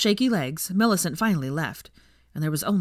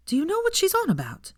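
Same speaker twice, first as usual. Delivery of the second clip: mock innocence; 14 semitones higher than usual. A narrator's reading. Hermione voiced, playing dumb.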